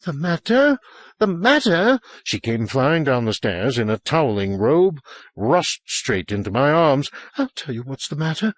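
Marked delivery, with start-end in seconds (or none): 0.0-2.0 panicked repeating. A narrator's reading. 7.4-8.5 breathless, rushed words; gasping out the line; out of breath. A narrator's reading. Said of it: "But out of breath she couldn't".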